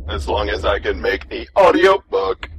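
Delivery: robot voice. Acted. Robot speaks.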